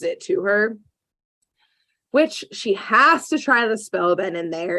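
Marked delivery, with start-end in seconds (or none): none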